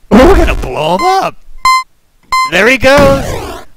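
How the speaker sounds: high-pitched, excited call-out; gleeful exclamation. Real, not acted. Player blowing up little Jake, gleeful and high-pitched. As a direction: crow it high as the bomb goes off.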